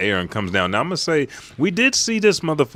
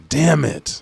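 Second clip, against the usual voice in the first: frustrated curse; 7 semitones higher, 4 dB louder than usual. Retold or imitated. Acted cursing when knocked back.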